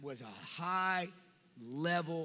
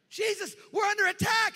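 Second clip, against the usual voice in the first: panicked shouting, much higher and louder; panicked disciples; 11 semitones higher, 6 dB louder than usual. Retold or imitated. Disciples shouting in terror at Jesus during the storm, panicked. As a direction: cry out that the boat is sinking. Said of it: "disciples freaking out".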